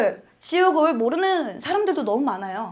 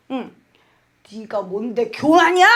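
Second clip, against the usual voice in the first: scolding her own spirit; 4 semitones lower than usual. Retold or imitated. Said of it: "제 영을 앞에다 놓고 엄청 싸움질을 했습니다".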